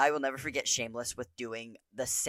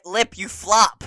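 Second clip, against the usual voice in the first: slow, loud, drawn-out taunt; loud slow jeer at Lip; mock scolding; 6 semitones higher, 11 dB louder, slower than usual. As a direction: drag out the insult. Reading a mocking viewer comment.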